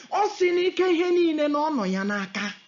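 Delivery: ordinary talk, nothing marked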